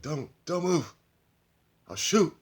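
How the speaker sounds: high, loud, panicky warning; a scared man holding a gun, voice jumping up; shaky threat. Acted. Lobo pointing the gun at the stranger, high and loud in fear. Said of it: "Shaking, kind of".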